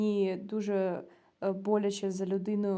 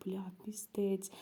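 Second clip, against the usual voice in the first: slow whispered swear; horrified whisper; 2 semitones lower, 7 dB quieter, slower than usual. Retold or imitated. Storyteller recalls her thought on hearing of the burns, whispered in horror. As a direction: whisper it to oneself, appalled.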